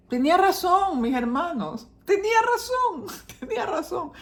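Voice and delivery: high, ringing repeats of tenía razón; emphatic exclamation. Real, not acted. Preacher to congregation, admitting daughter was right, excitedly.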